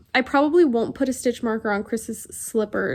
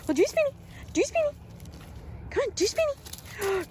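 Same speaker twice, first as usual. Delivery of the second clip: talking to a dog; 13 semitones higher, 6 dB quieter than usual. Real, not acted. Coaxing the dog.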